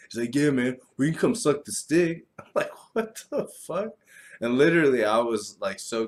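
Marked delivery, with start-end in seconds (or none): 0.3-2.3 neighbor voice. Retold or imitated. Neighbor voiced in retold encounter. 2.9-3.9 high, slow, incredulous; utterly confused retelling; drawn-out baffled disbelief. Retold or imitated. His own stunned reply retold.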